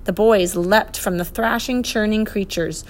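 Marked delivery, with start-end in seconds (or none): none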